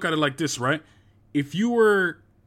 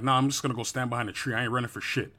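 muttered retold quote; 6 semitones lower, 5 dB quieter than usual. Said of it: "I said".

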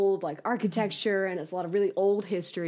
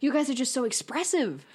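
higher, admiring teacher voice; professor quote; 6 semitones higher than usual. Retold or imitated. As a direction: praise them brightly. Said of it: "He said".

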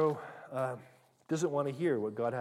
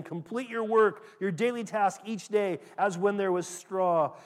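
loud, slowed barking orders; urgent command; 4 semitones higher, 7 dB louder than usual. Acted. Taskmasters pressing the slaves, loud urgent orders. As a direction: drive the slaves hard. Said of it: "were urgent".

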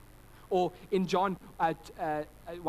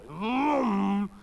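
loud, rising vroom of an engine; car engine vroom; 5 semitones higher, 8 dB louder than usual. Retold or imitated. Preacher imitating a passing car, loud vroom. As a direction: roar like a car zooming past.

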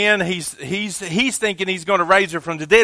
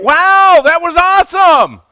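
much higher, loud shout of wonder; mock amazed shout; 11 semitones higher, 10 dB louder, slower than usual. Preacher mimicking churchgoers wowed by the miracle, hammed-up shout. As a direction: gush like a wowed fan, hammed-up. Mocking how we'd react.

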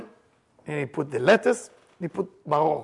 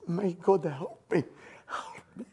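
desperate plea sinking into a whisper; plead, losing your voice by the end; fading plea; 8 dB quieter, slower than usual. Retold or imitated. Sufferer begging God through bitter maror, fading plea.

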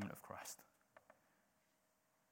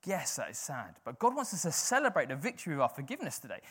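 normal talking voice

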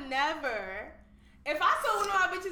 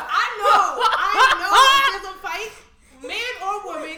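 laughing; 5 semitones higher, 17 dB louder, slower than usual. Real, not acted. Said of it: "laughing insistence".

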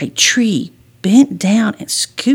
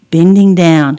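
plain reading voice

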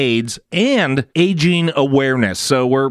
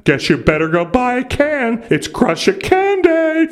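mocking voice; 9 semitones higher than usual. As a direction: tease them like a smug know-it-all. Mocking the listener's objection.

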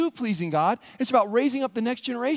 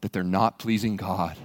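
spooky guide. Acted. Guide warning the pilgrim about the dark path, ominous. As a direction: sound like a guide at a haunted trail.

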